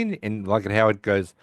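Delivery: usual speaking voice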